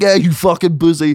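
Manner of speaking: imitated heckling; mock jeer. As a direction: jeer at a mate. Imagined friends jeering at him.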